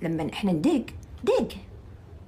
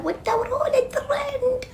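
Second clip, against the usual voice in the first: mock whisper; 3 dB quieter than usual. Mocking critics' quote.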